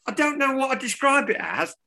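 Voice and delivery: high mock pundit voice; light send-up of the commentator; imitating Gage. Quoting the pundit's "scoop", high and teasing. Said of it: "He said".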